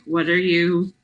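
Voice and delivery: ordinary delivery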